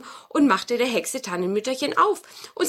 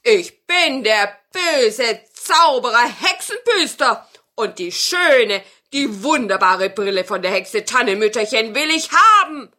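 evil wizard; 8 dB louder than usual. Acted. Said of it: "rief es draußen".

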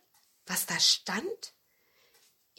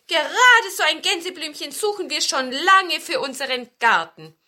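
laute, hohe Zwergenstimme; rufende Zwerge; 6 semitones higher, 8 dB louder than usual. A narrator's reading. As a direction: antworte dem Besen laut und lebhaft als Zwerg. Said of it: "riefen die Zwerge". (kräftig rufend, hoch angesetzt)

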